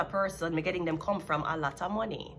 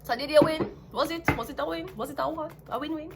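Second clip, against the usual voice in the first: sarcastic taunt; 8 semitones higher than usual. Real, not acted. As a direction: poke at him, doubting he won. Taunting question to the querent.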